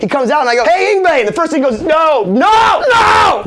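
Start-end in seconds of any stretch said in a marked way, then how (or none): 0.6-1.2 calling out. Retold or imitated. Calls out to the guitarist. 1.8-3.4 high, loud no, climbing each time; mock shouted refusal. Imitates [name] refusing a fan.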